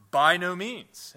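slow, loud and firm, each word weighted; emphatic. Retold or imitated. Preacher reading Paul's emphatic answer, slow and loud. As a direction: land "By no means." like a verdict. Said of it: "Paul answers with this emphatic".

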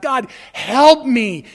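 loud, slow cry for help; desperate prayer, acted at full volume; urgent plea. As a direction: call out to God, leaning hard on each word. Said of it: "this urgent dependence upon God".

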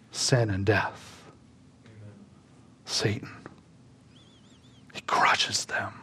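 almost breathless whisper, drawn out; hushed whisper. Real, not acted. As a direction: whisper it, very softly and slowly. Preacher drops to a hush.

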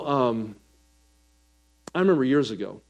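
usual speaking voice

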